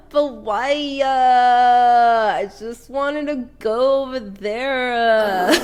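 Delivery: hammed-up whiny pleading. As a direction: whine it like a sulky kid, for laughs. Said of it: "You do your whiny voice".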